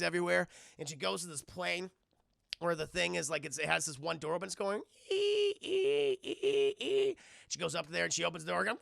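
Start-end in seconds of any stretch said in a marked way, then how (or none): 5.1-7.2 high squeaky creature cries, mostly far from his own voice; creature squeaks. Acted. Hidden creature squeaking from the plane, high squeals.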